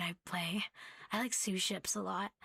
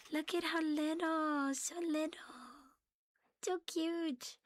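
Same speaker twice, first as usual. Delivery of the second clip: cutesy cooing; 7 semitones higher, slower than usual. Real, not acted. A cat vlogger coos at the tiny figure, high and cutesy. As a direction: gush like it's a tiny kitten.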